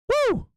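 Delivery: high whooping cheer; excited cheer. Real, not acted. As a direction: let out a happy whoop. Cheering a donor's name.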